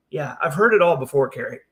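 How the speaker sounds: ordinary talk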